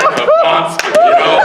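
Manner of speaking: high-pitched panicky squeak; squeaky high. Pretend. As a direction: squeak it out in mock fright.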